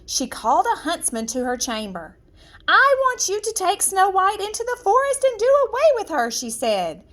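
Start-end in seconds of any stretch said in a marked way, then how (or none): 2.7-6.3 high, fast and cold royal order; a queen giving a murderous command quickly; icy queen voice, higher and hurried; cruel queen. Acted. Queen orders huntsman to kill.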